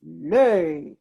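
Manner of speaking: loud barked giant command; giant's shout. A narrator's reading. Giant commanding the hen, loud bark. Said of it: "the giant shouted".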